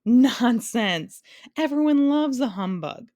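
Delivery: shouting humbug brushing off an insult. A narrator's reading. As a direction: bellow the protest grandly. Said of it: "shouted the humbug".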